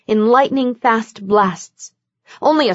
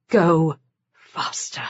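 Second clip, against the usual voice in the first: slow, lower, unvoiced whisper; whispered urging; 4 semitones lower, 5 dB quieter, slower than usual. A narrator's reading. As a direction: whisper it to yourself, slowly. Inner urging thought.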